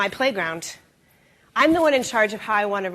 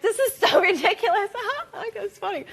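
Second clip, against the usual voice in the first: laughing; 10 semitones higher than usual. Retold or imitated. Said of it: "They're like".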